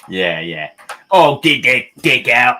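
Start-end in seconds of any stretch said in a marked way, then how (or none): 1.1-2.6 loud, higher hammed-up Cockney; mock sexy Cockney. Painter obliges friend's wish for sexy talk, mock Cockney. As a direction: ham up dirty talk in Cockney. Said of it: "sexy talk from a Cockney".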